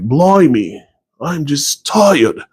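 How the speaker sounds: put-on drawn-out, weary British accent; British accent, slow stretch on "tired". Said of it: "I did that accent".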